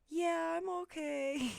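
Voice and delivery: high, slow, lightly voiced small child; a light imitation of a shaken little girl; little kid voice. Little girl reassures the candy lady after falling, in a high slow child voice.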